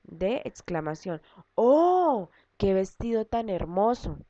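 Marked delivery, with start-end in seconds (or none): 1.5-4.2 oh alto y maravillado, como quien ve algo precioso; asombro. Shown as a demonstration. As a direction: exclama con asombro ante el vestido. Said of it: "Estamos expresando asombro". (con asombro y emoción)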